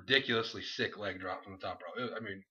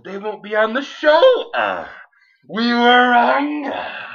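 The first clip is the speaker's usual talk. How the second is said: full-force hammed-up promo delivery; over-the-top wrestling promo; 13 semitones higher, 15 dB louder, much slower than usual. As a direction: bellow it slowly like a hyped-up wrestler.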